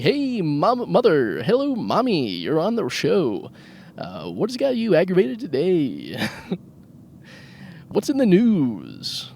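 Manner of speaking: hammed-up high DJ voice calling mom on air; radio host voice. To his mom on his pretend car radio show, hammed-up DJ voice. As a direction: play a cheesy talk radio host taking a call. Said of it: "and I'm like".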